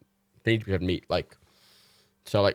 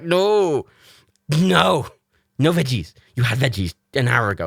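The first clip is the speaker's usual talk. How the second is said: mock stern scolding; 5 semitones higher, 7 dB louder, slower than usual. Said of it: "And I'm like".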